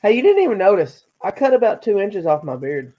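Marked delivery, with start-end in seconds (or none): none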